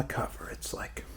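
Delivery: breathy whispered aside. Real, not acted. To the viewer, recalling the album cover, whispered. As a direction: drop to a secret whisper.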